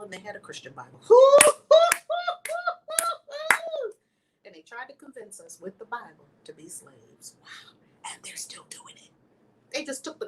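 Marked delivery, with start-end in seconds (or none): 1.2-3.9 high shrieking laugh. Real, not acted. Laughing at slave Bible. 7.4-9.1 whisper. Real, not acted. Stunned hushed remark.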